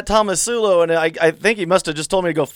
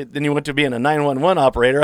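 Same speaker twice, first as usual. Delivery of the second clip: ordinary talk, nothing marked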